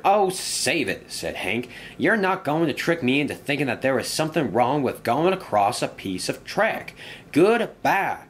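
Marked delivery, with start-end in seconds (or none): 0.0-1.0 high, drawn-out, fed-up retort; dismissive interruption. Acted. Engine cutting short a friend's tale, dismissive. 2.0-8.2 higher-pitched, fed-up engine character; defiant engine. Acted. Engine refusing to be tricked, higher and defiant.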